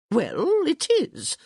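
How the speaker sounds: high, loud, indignant protest; defensive. A narrator's reading. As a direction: bristle and defend yourself. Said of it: "Lady D said defensively".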